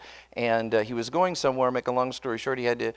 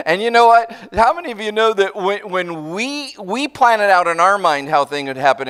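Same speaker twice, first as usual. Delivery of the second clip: higher, louder, fired-up preaching; excited sermon call-out; 8 semitones higher, 8 dB louder than usual. Real, not acted. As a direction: lift the crowd with rising energy. Preacher builds excitement to crowd.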